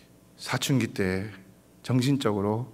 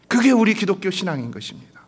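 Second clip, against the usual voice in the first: higher, louder proclamation; preaching proclamation; 9 semitones higher, 5 dB louder than usual. Real, not acted. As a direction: proclaim it with force. Loud restatement after whisper. (높고 크게 선포하듯)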